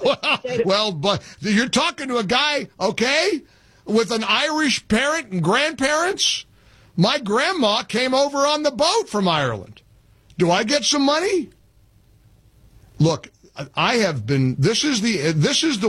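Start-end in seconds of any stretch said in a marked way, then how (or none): 0.0-11.5 incredulous. Real, not acted. Host mocks caller's reparations claim.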